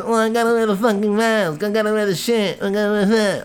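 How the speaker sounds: a hint of a whiny complainer; mock the mask complainers lightly; mocking whiner. Ranter lightly mimicking people who whine about masks.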